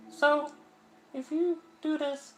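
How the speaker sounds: a high voice pushing help away; high girlish distressed voice; Sayori's voice. Acted. Voicing Sayori.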